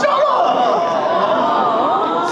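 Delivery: high, loud drawn-out outburst; overwhelmed mock yell. Actor to partner after a touching compliment, mock wail. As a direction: wail it out in playful protest.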